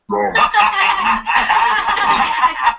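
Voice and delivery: loud high burst of laughter; big laugh. Real, not acted. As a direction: crack up hard. Riffing on combat sheep cops.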